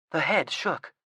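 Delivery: usual speaking voice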